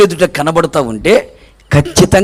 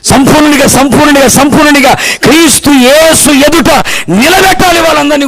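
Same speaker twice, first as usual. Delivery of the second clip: insistent, raised pulpit delivery; full-force preaching; 7 semitones higher, 3 dB louder than usual. Real, not acted. Preacher urging that all stand perfect before Christ, full-force preaching. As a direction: hammer the repeated word, rising into proclamation.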